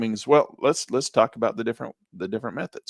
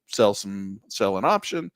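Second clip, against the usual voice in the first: ordinary delivery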